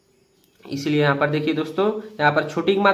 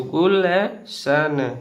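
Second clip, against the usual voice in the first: गुल and सन split apart slowly; syllable by syllable; much slower than usual. Shown as a demonstration. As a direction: break Gulshan into slow parts. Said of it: "तो गुलशन हो गया".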